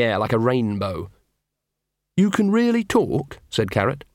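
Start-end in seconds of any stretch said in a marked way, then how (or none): 2.2-3.4 high, slow, amazed question. Acted. Carrot asking the dog in high, slow amazement. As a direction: disbelief at a talking dog.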